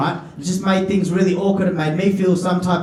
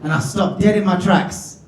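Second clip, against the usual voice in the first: ordinary talk, nothing marked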